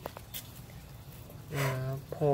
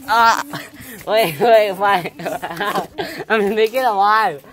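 excited giggling; 7 semitones higher, 9 dB louder than usual. Real, not acted.

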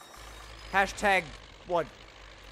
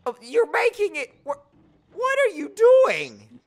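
exasperated yell; 11 semitones higher, 7 dB louder than usual. Real, not acted. Friend drives into his tractor.

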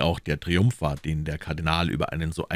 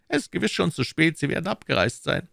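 higher, pressing questions as the Cardinal; Cardinal questioning; 12 semitones higher than usual. Acted. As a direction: interrogate his agent coolly. (der Kardinal, verhörend)

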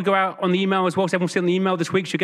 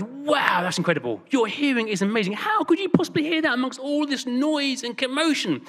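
high, amazed exclamation; acted-out incredulous wonder; astonished; 8 semitones higher than usual. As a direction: gush with mock amazement at what was heard. New Yorker astonished in retold story.

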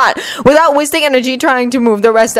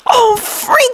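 startled; 12 semitones higher than usual. Acted. Guard startled in elevator.

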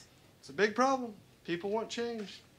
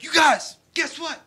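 put-on excited kid; 7 semitones higher, 6 dB louder, slower than usual. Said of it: "he was like".

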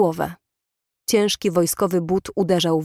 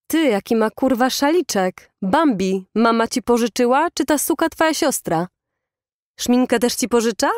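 higher-pitched jeering questions; mocking taunt; 5 semitones higher than usual. A narrator's reading. Bully taunts cornered schoolboy about his scarf, jeering. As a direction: needle him with mock questions. Bullies surround and taunt Adam.

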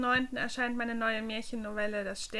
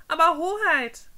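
loud, high call of protest; 7 semitones higher, 9 dB louder than usual. Acted. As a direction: call out to stop him. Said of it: "rief Henry".

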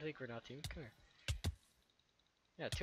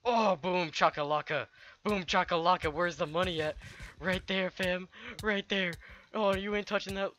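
loud hype; 8 semitones higher, 11 dB louder than usual. Real, not acted. Hype over loot kill.

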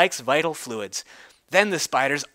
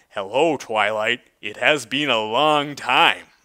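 charming alicorn voice; 7 semitones lower than usual. Acted. Handsome alicorn voiced by teller.

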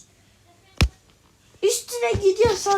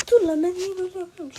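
ordinary delivery

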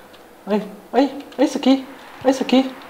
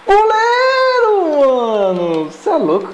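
grito empolgado; 11 semitones higher, slower than usual. Real, not acted. Elogiando defesa do goleiro.

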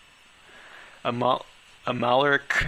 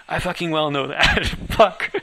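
louder retort dissolving into a laugh; 4 semitones higher, 6 dB louder than usual. Acted. Narrator retorts and breaks into laughter. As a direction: snap back then crack up.

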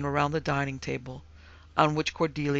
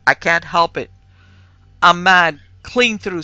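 much louder, emphatic retort; angry outburst; 3 semitones higher, 13 dB louder than usual. A narrator's reading. Husband retorting to his sobbing wife, angry outburst. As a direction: hurl it out in exasperation.

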